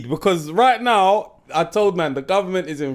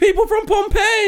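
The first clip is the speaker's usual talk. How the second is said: very high, insistent retort; high-pitched exasperated comeback; 13 semitones higher than usual. Real, not acted. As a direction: insist at the top of your range. Heated argument over pronunciation.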